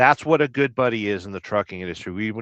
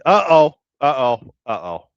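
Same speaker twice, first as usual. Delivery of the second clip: mock alarm that dies away; 3 semitones higher, 6 dB louder than usual. Host teasing a chatter's CB handle, mock alarm fading off.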